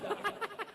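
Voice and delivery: short high burst of laughter. Real, not acted. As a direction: laugh at the punchline. Laughing at own testimony. (हल्की हँसी)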